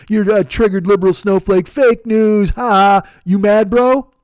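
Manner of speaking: loud, jeering bro imitation; mocking troll pile-on. Online troll zinging a liberal, loud mock jeer. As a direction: rattle off taunts as a loud troll.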